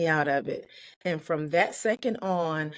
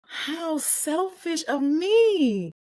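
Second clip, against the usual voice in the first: very high, very slow, hushed self-reproach; shocked at herself, slow and high; stricken realization; 10 semitones higher, much slower than usual. Retold or imitated. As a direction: let the shame hit you mid-sentence. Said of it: "I was like".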